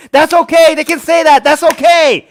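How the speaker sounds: loud, high and emphatic; angry insistence. Real, not acted. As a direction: hammer the point home, exasperated. Angry rant, insisting on the point.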